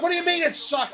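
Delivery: very high indignant comeback; retold screaming. Answering Wendy's shrug in the dream, retold high-pitched outrage. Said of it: "I'm screaming in the".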